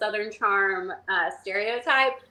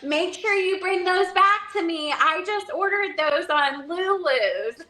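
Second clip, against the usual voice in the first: mom; 9 semitones higher than usual. Retold or imitated. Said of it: "then she was like".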